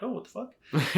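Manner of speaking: high, laughing what-the-hell; half-laughing, caught off guard by the find; laughing disbelief. Retold or imitated. Reacting to the laser at the booth with laughing disbelief. Retelling finding the odd laser.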